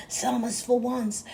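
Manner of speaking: usual speaking voice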